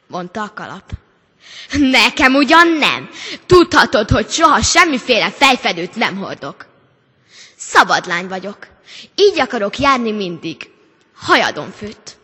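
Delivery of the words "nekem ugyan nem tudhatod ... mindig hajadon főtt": she throws the hat off with words, firmly; defiant refusal. A narrator's reading. (dacosan, hangosan)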